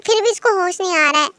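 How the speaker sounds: plain reading voice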